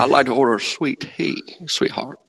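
put-on Southern accent, as if through a mouthful of marbles. Shown as a demonstration. Co-host showing off how to talk in a Southern accent. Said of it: "That's my Southern accent".

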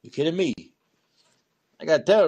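ordinary delivery

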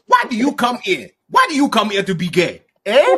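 loud, high mock African interrogation; generic African accent. Grilling someone about why they came, African accent. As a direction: press them in a put-on African accent. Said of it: "generic African".

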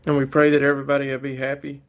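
fervent, fast prayer voice. Acted. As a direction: pray it out earnestly and quickly. Leads a family prayer at home.

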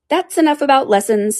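high, loud, firm cut-off; very decided tone. Acted. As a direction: shut the topic down. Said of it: "in a very decided tone".

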